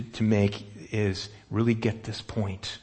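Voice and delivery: ordinary talk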